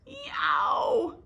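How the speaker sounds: high loud pained yow; loud yelp. Acted. Abel stung on the nose, yelping loud. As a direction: yelp as the sting lands. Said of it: "Abel yammered".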